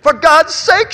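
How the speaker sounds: high loud sobbing cry; distraught mother crying out. A narrator's reading. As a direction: sob it out in despair. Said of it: "she sobbed".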